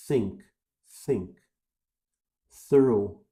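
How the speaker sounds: think, think, thorough, tongue against finger; th with air on the lips; th with finger test. Shown as a demonstration. Teacher demonstrates the finger test. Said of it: "quando você faz isso".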